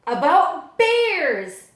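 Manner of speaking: slow, loud, high; drawn-out dramatic bear announcement; big announcement. Acted. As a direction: announce it like something thrilling. Librarian announces bear book.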